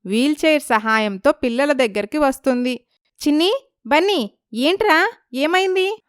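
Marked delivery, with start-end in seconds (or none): 3.3-6.0 put-on high, worried calling to the children; alarmed mother calling out; anxious mother. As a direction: rush in fearing the worst. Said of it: "రాణి కంగారుగా".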